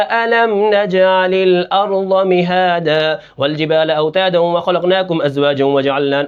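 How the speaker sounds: chanted Quran with uneven, lurching tempo; rushed then leisurely recitation. Shown as a demonstration. Teacher shows students a recitation fault, hurrying in places and slowing in others. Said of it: "کہیں جلدی کرنا".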